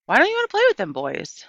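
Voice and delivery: put-on old woman asking a child; lightly voiced old woman. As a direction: ask gently, like a grandma. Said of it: "Gloria asked me".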